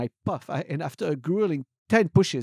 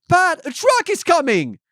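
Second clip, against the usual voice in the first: high, loud, drawn-out scream; slow high-pitched panicked shout; exclaimed warning; 11 dB louder, slower than usual. Acted. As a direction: scream a warning to your child. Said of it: "I exclaim".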